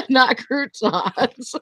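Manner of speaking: slow, laughing through the words; giggling while setting the record straight; laughing correction. Real, not acted. Laughing at a friend who misheard.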